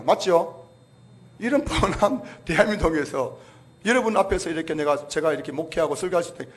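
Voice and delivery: ordinary talk, nothing marked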